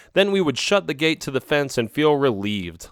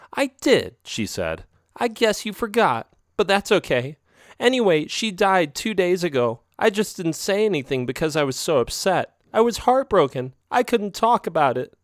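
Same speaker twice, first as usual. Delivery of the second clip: tearful; 7 semitones higher than usual. Acted. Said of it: "She cried again".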